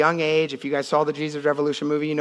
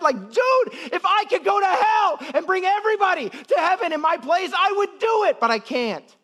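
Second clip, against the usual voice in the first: preaching at full force. Acted. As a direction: cry out Paul's plea from the pulpit. Preaching Paul's cry.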